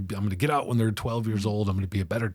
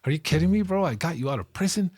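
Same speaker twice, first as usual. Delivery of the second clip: higher, louder indignant outburst; outraged retort; 9 semitones higher, 4 dB louder than usual. Retold or imitated. Man to the brother who betrayed him, higher and louder in outrage. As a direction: throw the betrayal back in his face. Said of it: "I'm like".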